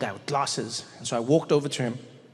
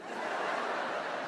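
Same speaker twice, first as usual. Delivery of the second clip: big sudden gasp of realization; mock horrified gasp; 10 dB quieter than usual. Mom seeing her forgotten kid walk in, mock gasp. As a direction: suck in air, imitating a shocked mom.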